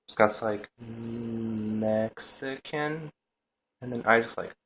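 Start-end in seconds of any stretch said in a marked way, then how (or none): 0.8-2.3 slow, soft, stretched hesitant answer; nervous hesitation. Retold or imitated. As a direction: imitate a nervous stall, softly drawn out. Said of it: "totally nervous and whatnot".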